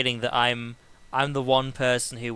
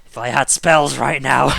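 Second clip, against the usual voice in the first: loud, slow mock fury; frustrated seething; 11 dB louder than usual. Outvoted warlock wishing for spells, seething. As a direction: strain it out in hammed-up frustration. Voices his character's powerless grumbling.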